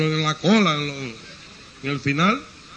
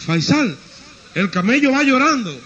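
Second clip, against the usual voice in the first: higher, louder alarmed call; louder, higher call to the rider behind; 7 semitones higher, 5 dB louder than usual. Acted. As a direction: shout back over your shoulder. Rider calls to the next.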